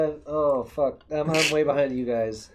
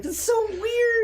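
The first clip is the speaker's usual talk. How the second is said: incredulous shout; slower than usual. Real, not acted. Mock outrage at Cheese Whiz.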